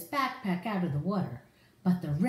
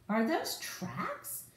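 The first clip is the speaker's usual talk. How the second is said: higher, slow and soft wondering; curious question; 8 semitones higher, 4 dB quieter, much slower than usual. Acted. Ryder spotting tracks in the snow, hushed wonder.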